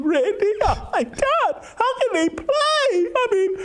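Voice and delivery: high, whiny mock sob; mock crying. Mocking Randy's tears on air, high fake sob. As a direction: ham up a tearful breakdown, pitched high.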